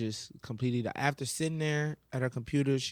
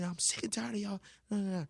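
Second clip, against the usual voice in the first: mocked teacher; 7 semitones higher than usual. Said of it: "she goes to her dad".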